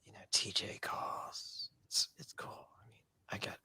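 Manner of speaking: hushed ASMR whisper, breathy and close. Retold or imitated. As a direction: whisper it like you're cool and above it all. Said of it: "this is the A S M R part of the podcast".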